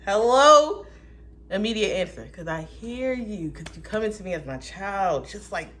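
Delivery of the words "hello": high, loud casual hello; hammed-up eager God; God answering fast. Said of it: "he be like".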